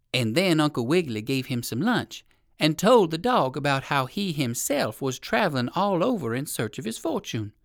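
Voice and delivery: ordinary delivery, nothing marked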